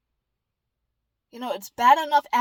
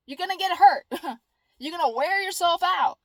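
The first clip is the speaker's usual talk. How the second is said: ordinary delivery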